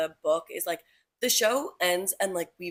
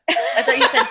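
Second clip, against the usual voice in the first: laughing; 7 semitones higher, 11 dB louder than usual. Real, not acted. Laughing at a mishearing.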